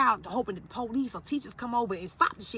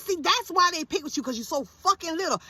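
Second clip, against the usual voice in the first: raised-pitch mother's rebuke; retold telling-off of a son; exasperated scolding; 6 semitones higher, 3 dB louder than usual. Acts out berating son.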